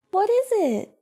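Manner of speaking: high-pitched coaxing question; sweet, high pet-owner voice; talking to pet. Real, not acted. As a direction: coo it to a dog. Coaxing her dog to respond.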